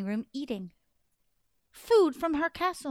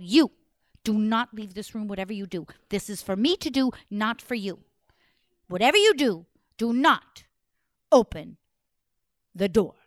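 stern order; 4 semitones lower, 5 dB louder than usual. Acted. Orders her sister firmly.

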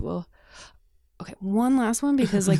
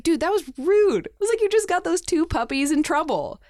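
mock scolding; 9 semitones higher, 4 dB louder than usual. Scolding boyfriend for whistling.

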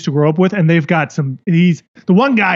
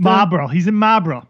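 Boston "Marlborough" with the r's dropped; name the town like a Bostonian; Boston accent. Shown as a demonstration. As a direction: local Boston pronunciation. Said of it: "you're doing great at the accent".

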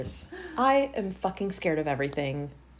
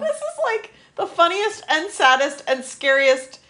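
high, loud, laughing through it; 5 semitones higher, 5 dB louder than usual. Real, not acted. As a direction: barely get it out for laughing. Cracking up over the episode.

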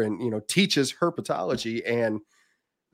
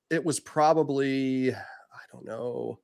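half-whispered musing; 2 semitones higher, 4 dB quieter, slower than usual. Real, not acted. Thinking aloud, unsure.

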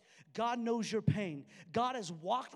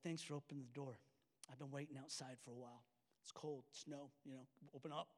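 much softer, friendly and kind; gentle; 8 semitones lower, 13 dB quieter, faster than usual. Retold or imitated. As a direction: speak softly, like a welcome. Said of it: "He's like".

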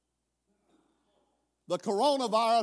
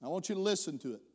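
ordinary delivery

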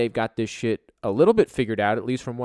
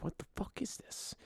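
very soft, voiceless muttered reaction; near-whispered retold bewilderment; hushed disbelief; 12 semitones higher, 17 dB quieter than usual. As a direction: whisper it to yourself, baffled. Retold puzzled reaction to a headache.

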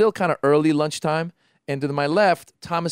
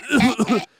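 mock cough and splutter; imitated choking cough; 8 semitones higher than usual. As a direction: act out coughing something up. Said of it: "he's trying to s-, cough and spit".

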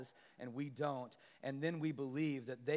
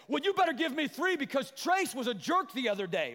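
put-on higher, louder childish demand; demanding kid; 10 semitones higher, 8 dB louder than usual. A sibling demands three cookies, loud and childish. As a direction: insist like a bossy sibling.

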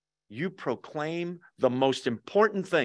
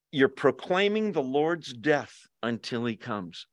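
usual speaking voice

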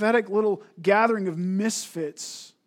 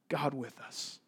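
soft answer; 4 semitones lower, 11 dB quieter, slower than usual. Shown as a demonstration. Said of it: "which means what?".

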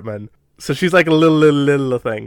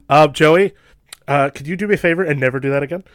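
ordinary delivery

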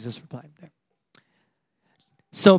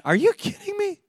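much higher, incredulous retort; 13 semitones higher than usual. Real, not acted. Preacher to doubting congregation: high, incredulous retort. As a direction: throw it back at them in disbelief.

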